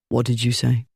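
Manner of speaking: low, mild and deadly calm; mild amiable tone. A narrator's reading. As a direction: menace hidden under a mild question. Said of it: "in the mild, amiable tone".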